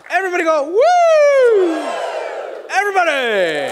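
high, loud cheerleader yell; crowd hype shout. Real, not acted. Magician firing up the audience, high loud hype shout. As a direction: whip the crowd into a woo. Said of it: "Tell you what".

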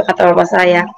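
usual speaking voice

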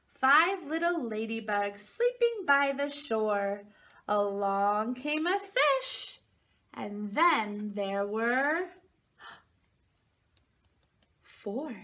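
slow, sing-song picture book reading; reading to a toddler. Acted. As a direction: read it like bedtime for a little one. Reads picture book to children.

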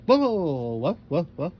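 loud, high startled babble; super shocked. A narrator's reading. DJ shouted awake, super shocked. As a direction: wake with a jolt, voice jumping up. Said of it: "D J awoke super shocked".

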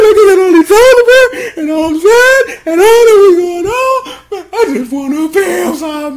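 hammed-up wailing, high and loud; fake-cry it like a crushed teen; mock sobbing. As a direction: sad hero begging friends, mock sobbing. I'm so sad.

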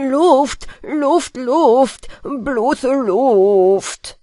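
loud, repeated anxious protest; mumbler's worried shout; anxious cry. A narrator's reading. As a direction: call out in mild fear. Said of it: "rief Peter ängstlich".

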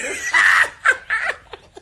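high shrieking burst of laughter; loud laugh. Real, not acted. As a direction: let out a big high laugh. Laughing at mom's memory.

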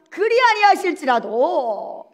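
loud, high, drawn-out proclamation; declaration of faith. Acted. Preacher voicing Daniel's unwavering faith, resolute declaration. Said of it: "이 믿음이 있었어요".

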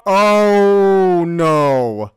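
normal talking voice

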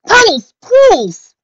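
very high, loud, drawn-out plea; slow, piercing exclamation; crying out. A narrator's reading. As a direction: call out shrilly to stop the argument. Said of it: "Pinkie cried".

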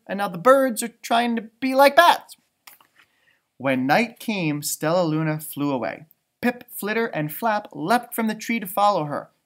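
usual speaking voice

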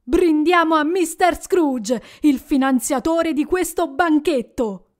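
cheerful toast. Acted. Bob toasts Scrooge.

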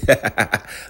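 loud, high-pitched laugh; burst of laughter. Real, not acted. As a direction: crack up at the photo. Laughing at [name]'s face.

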